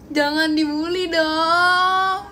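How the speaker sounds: loud, drawn-out plea; playful protest. Real, not acted. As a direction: whine loudly at teasing friends, stretching the last word. Protests chat's teasing.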